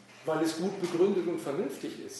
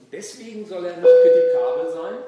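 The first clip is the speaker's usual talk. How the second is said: raised voice, indignant; loud indignant; 7 semitones higher, 14 dB louder than usual. Real, not acted. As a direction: push back hard, louder. Heated argument with a colleague.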